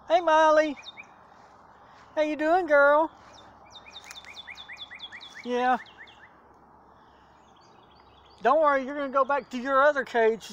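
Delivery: high gentle cooing to a dog; pet talk. Real, not acted. Talking sweetly to the dog.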